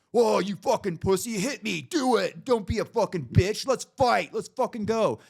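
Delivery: high, loud imitated goading yell; mock a hothead egging someone into a fight; aggressive taunting. Stranger daring him to fight, imitated aggressive shouting. Acted parking-lot challenge to fight.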